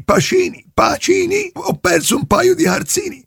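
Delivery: name called out high; high insistent calling. Acted. Caller shouting his name.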